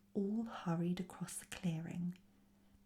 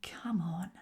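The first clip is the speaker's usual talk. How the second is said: a touch of puffing; lightly puffed urging, timbre changed; 4 dB louder than usual. A narrator's reading. Goblin urges his companion onward, puffing slightly. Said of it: "puffed one of the goblins".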